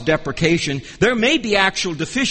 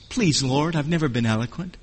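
meek plea; 3 semitones lower, 6 dB quieter than usual. Acted. Moses pleading with God.

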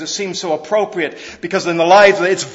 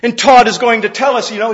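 ordinary delivery

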